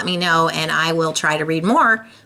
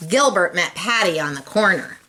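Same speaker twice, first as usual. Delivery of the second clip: ordinary delivery, nothing marked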